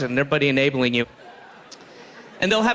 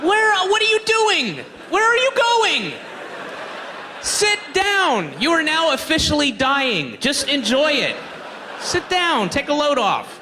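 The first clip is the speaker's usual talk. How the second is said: mock yelling at junkies; 9 semitones higher than usual. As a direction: shout at the wandering junkies to sit. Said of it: "It's just like these guys".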